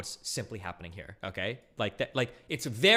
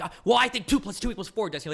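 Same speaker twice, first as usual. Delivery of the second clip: higher, louder smug opponent voice; mock opponent voice; 8 semitones higher, 6 dB louder than usual. Streamer mimics his debate opponent stating the obvious, smug and high. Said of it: "he's like".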